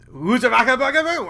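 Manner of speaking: high, loud mimicry of an irritating catchphrase; mocking catchphrase imitation. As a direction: parrot it loudly in a high silly voice. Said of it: "sick of that fucking phrase".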